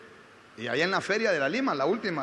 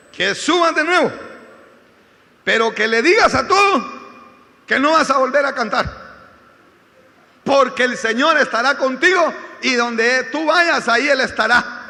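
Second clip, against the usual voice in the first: loud, raised, emphatic message; retold prophetic proclamation; 6 semitones higher, 12 dB louder than usual. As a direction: proclaim God's promise at full volume. Girl delivers God's message, retold. (proclamación profética a plena voz)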